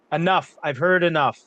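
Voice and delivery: loud, sharp call for order; queen silencing the quarrelling council, loud and commanding. Acted. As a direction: cut the shouting dead.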